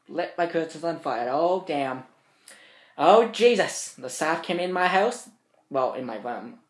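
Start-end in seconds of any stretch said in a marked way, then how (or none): none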